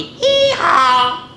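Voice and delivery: high hee-haw, stretched out; imitated donkey call; donkey bray. A narrator's reading. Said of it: "Like Wonky Donkey".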